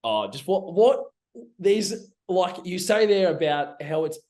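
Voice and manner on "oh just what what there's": ordinary talk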